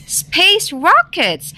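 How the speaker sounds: high, drawn-out excited cry. A narrator's reading. Rabbit pitches a story idea to Wolf, excited cry. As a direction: cry it out high, stretching the words. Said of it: "cried Rabbit".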